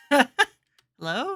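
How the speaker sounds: high, loud questioning hello; calling out. Acted. Visitor walks in on the bathing nun, calling out. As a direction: call out loudly to find someone.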